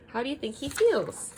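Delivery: ordinary talk, nothing marked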